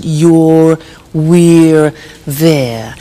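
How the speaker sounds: "you're," "we're," "there." each said slowly and on its own, with the r-vowel sounds drawn out; slow, deliberate "you're," "we're," "there."; careful pronunciation. Shown as a demonstration. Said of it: "Be careful with the pronunciation".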